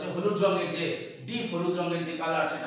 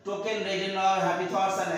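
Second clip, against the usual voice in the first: slow poem recitation; much slower than usual. Shown as a demonstration. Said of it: "দেখো কোথায় imagery আছে".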